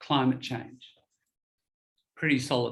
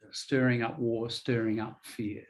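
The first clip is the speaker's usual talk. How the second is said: usual speaking voice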